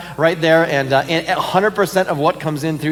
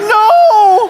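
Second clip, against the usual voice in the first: high, loud wail with a laugh in it; anguished no; 7 dB louder than usual. Retold or imitated. Fan hears boarding call during overtime, laughing howl of protest.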